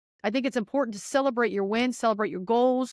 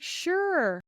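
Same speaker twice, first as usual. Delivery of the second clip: breezy sure; 9 semitones higher than usual. Retold or imitated. Retold casual reply.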